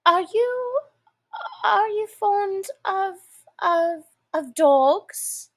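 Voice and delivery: put-on high, halting eager girl; eager stumbling question. A narrator's reading. Alice eagerly changes the subject with the mouse. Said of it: "Alice went on eagerly".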